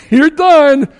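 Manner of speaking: high, loud mock verdict; mock gatekeeper. Guard catching a fugitive, mock dismissal. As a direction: gleefully condemn the mispronouncer. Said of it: "It's like".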